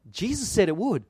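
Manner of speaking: normal talking voice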